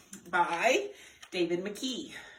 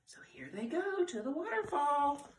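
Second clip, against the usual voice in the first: ordinary delivery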